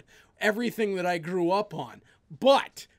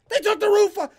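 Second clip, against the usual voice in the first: outraged shout; 10 semitones higher, 7 dB louder, faster than usual. Real, not acted. Rant about ruined wrestling legacy.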